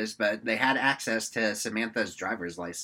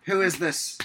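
telemarketer spiel; 5 semitones higher, 4 dB louder than usual. Retold or imitated. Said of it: "they're like".

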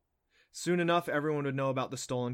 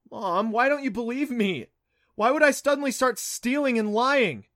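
pleading protest; 11 semitones higher, 9 dB louder than usual. Acted. Son pleads desperately with mother.

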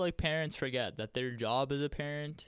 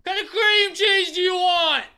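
high, very loud yelled question; scream across store; 9 dB louder than usual. Acted. Asking a friend about groceries across Walmart, high full scream. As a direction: holler it at full volume across aisles. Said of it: "I'm very good at screaming".

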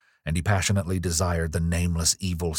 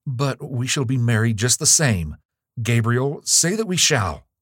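louder, higher entreaty; urgent pleading; 6 semitones higher, 5 dB louder than usual. Acted. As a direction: plead for a promise, pitch climbing. Girl pleads for promise of marriage.

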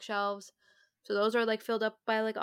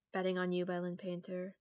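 ordinary talk

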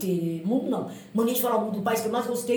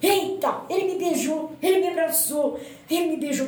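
high-pitched breathless gushing; reliving giddy disbelief; overjoyed exclaiming; 6 semitones higher than usual. Retold or imitated. Runner exclaiming after the prince kissed her, overjoyed.

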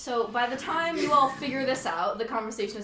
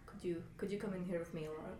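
discreet summons; 4 semitones lower, 10 dB quieter, faster than usual. Acted. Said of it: "he calls Alora into the next room".

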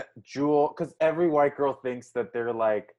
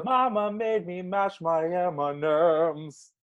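slow, higher, deliberate drill; slow vocal exercise; 4 semitones higher, much slower than usual. Shown as a demonstration. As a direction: draw each syllable out. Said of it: "These were all vocal exercises".

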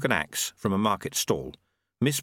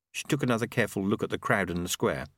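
usual speaking voice

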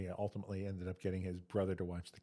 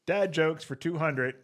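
higher, louder, slow quiz-show announcement; game show call; 7 semitones higher, 9 dB louder, slower than usual. Pretend.

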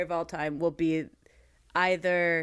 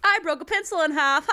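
loud, high giddy quote; mock-hyper exclamation; imitated hyper outburst; 7 semitones higher, 5 dB louder than usual. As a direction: blurt it out with manic glee. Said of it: "he's just all kinds of hyper".

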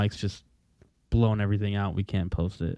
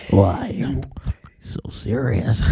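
slow whispered villain line; menacing whisper; much slower than usual. Acted. Co-host echoes a film quote, villainous whisper. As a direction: whisper it like a playful movie villain.

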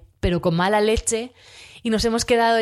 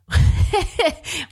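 laugh; 10 semitones higher than usual. Real, not acted. Teacher laughing at funny videos.

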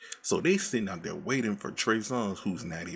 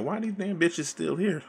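annoyed driver; 10 semitones higher than usual. Retold or imitated. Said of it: "He's like".

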